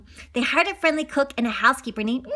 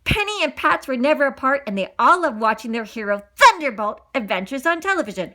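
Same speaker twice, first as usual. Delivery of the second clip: plain reading voice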